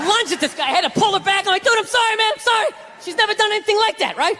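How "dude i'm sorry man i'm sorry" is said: flustered apology. Retold or imitated. Owner apologizing while pulling dog back.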